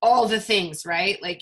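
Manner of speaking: normal talking voice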